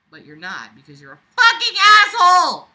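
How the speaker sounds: high, loud furious outburst; furious yell. Real, not acted. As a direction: explode at the writer. Reader yelling at the author.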